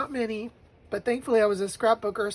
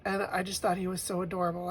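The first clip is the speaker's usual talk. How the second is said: ordinary talk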